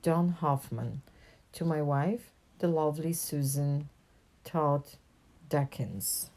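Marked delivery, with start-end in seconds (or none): none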